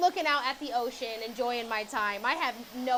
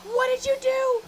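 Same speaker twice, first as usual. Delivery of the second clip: freaking out; 8 semitones higher than usual. Retold or imitated. Woman freaking out at stylist Jennifer, high frantic questions. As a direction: fire questions at the stylist in a panic. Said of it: "freaking out at her".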